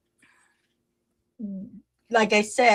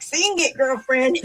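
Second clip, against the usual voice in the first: put-on high, playful "sing it, girlfriend"; sassy cheer; 4 semitones higher than usual. Fan cheering the singers on, sassy and high. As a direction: hype them up like a fan. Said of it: "I'm like".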